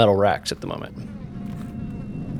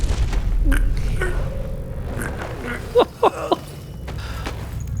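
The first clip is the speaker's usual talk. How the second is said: high breathy laugh; 5 dB quieter than usual. Real, not acted. Long laugh during gameplay.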